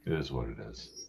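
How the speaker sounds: whispered resigned aside. Real, not acted. Shrugging off his friends' mockery in a whisper. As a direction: breathe it out with no voice.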